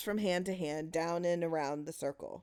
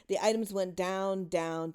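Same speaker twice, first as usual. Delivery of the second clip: plain reading voice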